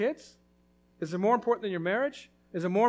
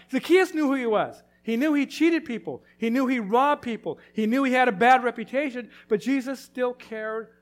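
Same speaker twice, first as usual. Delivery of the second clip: louder, higher, pounding each line; fervent preaching; 4 semitones higher, 6 dB louder than usual. Real, not acted. Preacher to congregation about Zacchaeus' sins, fervent and loud. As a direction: hammer the sins home.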